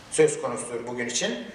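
ordinary talk

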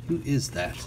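usual speaking voice

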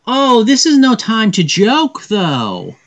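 high-pitched princess protest; princess chiding. Acted. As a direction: scold them like a distressed princess. Princess scolds the joking agent.

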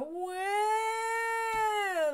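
high mock baby wail; a short imitated baby "wah". Lecturer imitates an abandoned baby's high cry. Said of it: "It's more of a".

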